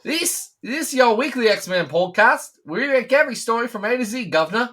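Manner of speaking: high, loud mock British accent. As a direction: ham up a British podcast host. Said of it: "the attempt at a British accent there".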